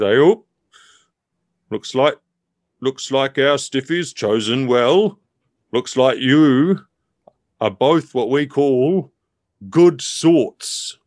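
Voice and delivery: slow, drawn-out approving teddy; big teddy character. Acted. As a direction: stretch the words out as an approving old-pal teddy.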